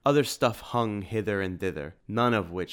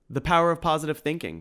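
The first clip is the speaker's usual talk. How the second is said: high, fast and louder piping; 8 semitones higher, 6 dB louder, faster than usual. A narrator's reading. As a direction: pipe it up brightly and quickly. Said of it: "piped Tim".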